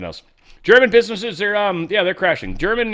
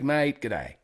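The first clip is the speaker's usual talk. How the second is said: playful Australian greeting; mock Aussie accent. Host greeting an Aussie he has found, mock Australian accent. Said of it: "Just find an Aussie. Be like".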